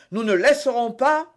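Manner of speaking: loud, high, indignant objection; exclaiming in protest. A narrator's reading. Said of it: "s'écria Porthos". (vive protestation)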